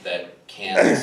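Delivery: ordinary delivery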